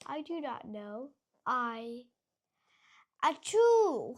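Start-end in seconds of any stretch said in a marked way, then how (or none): 3.2-4.1 big hammed-up achoo; loud sneeze. Gerald sneezes in front of Piggy, loud and big.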